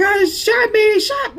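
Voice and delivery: high-pitched mimicry of a terrified boy; retold terror in a high voice; imitated panic. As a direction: echo a child's panicked cry, voice pitched right up. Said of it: "hear the panic and the terror".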